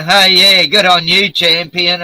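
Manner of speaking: high, loud mock praise; put-on hearty cheer; mock congratulation. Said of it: "So I go".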